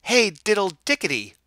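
loud, high squeaky chant; mice answering. A narrator's reading. Mice answer the cat, loud high and squeaky. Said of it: "answered the little mice".

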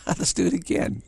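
high, laughing playful voice; high child voice breaking into a laugh; giddy laugh. Retold or imitated. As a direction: sound like a kid who just tumbled. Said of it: "It's like".

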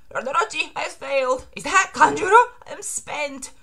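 high, strained feeble voice; spent servant. Acted. Failed servant reporting to his lord, high and feeble.

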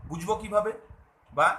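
ordinary delivery

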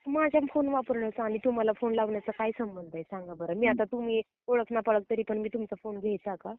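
usual speaking voice

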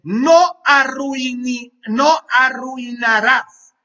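no arruinarás, alto y lento; plántale cara al diablo, fuerte y despacio; firme al diablo. Acted. (reto firme al diablo, fuerte y lento)

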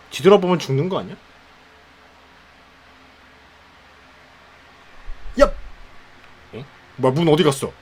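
nervous fast scared voice; scared horror game streamer. Real, not acted. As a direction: talk fast, spooked.

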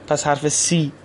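usual speaking voice

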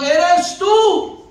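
loud, high, emphatic call. Acted. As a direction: call it out with sudden certainty. Pedro calling out to Jesus.

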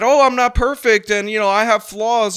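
high, loud mimicry of a self-excusing confession; mocking imitation. Host mimics commentator's aside into the mic, mockingly high and loud. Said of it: "I'm gonna whisper into the mic".

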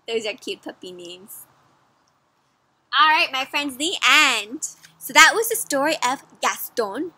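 ordinary delivery, nothing marked

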